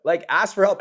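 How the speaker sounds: loud repeat; loud follow-up urging the student. Acted. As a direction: hammer the point home loudly. Yelling at imagined student.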